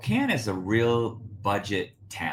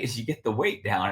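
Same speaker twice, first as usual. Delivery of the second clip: ordinary talk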